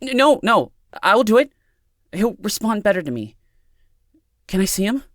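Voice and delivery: high, stammering, hurried agreement that softens; anxious pleading. A narrator's reading. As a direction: give in anxiously, then plead. Panicked Amanda gives in and pleads.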